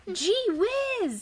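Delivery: high, wide-eyed gee whiz; amazed Santa. Acted. Reading to a child, Santa amazed by the flying reindeer. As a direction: gasp it out in wonder. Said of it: "said Santa".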